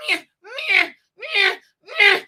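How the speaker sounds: high, wailing cat cries; mock yowling meows. Host imitates the tomcat while complaining, high wailing meows. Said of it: "I'm like".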